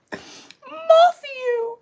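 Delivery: put-on very high, loud little-boy voice; giggling little boy. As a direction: imitate a small child, stretched and squeaky. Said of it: "that little boy who's giggling".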